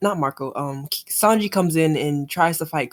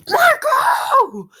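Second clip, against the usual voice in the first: battle cry; 6 dB louder than usual. Acted. Reading battle shout.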